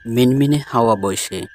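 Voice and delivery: plain reading voice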